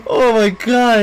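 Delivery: ordinary talk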